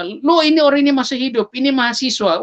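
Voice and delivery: high, quick astonished exclamation; startled bystander. Retold or imitated. Onlookers telling each other the body is alive, acted surprise. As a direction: re-enact startled onlookers, words tumbling out.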